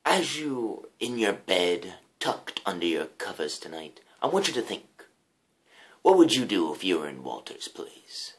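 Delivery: host's hushed mock-dramatic sign-off; hushed outro. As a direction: lean in and murmur to the viewer. Spooky whispered outro address.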